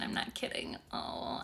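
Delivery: words slipping out on breath; getting emotional. Real, not acted. Moved by a viewer's comment, breathy and tearful. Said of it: "you make me get super emotional".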